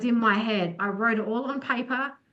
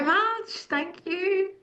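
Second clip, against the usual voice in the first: high, drawn-out grateful thanks; touched thanks; 10 semitones higher, much slower than usual. Real, not acted. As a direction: thank her, visibly touched. Touched by compliment.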